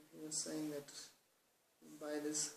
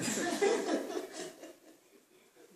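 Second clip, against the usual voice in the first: high breathy burst of laughter; big laugh; 14 semitones higher, 7 dB louder than usual. Real, not acted. Teacher cracks up at his own fan joke, laughing hard.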